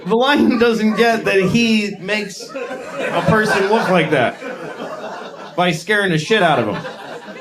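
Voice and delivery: normal talking voice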